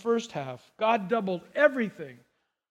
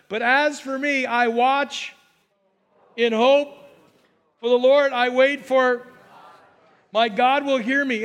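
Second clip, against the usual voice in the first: louder, proclaiming scripture; loud scripture reading; 3 semitones higher, 5 dB louder than usual. Acted. Preacher reads Micah aloud to the congregation, proclaiming. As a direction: proclaim the verse to the church.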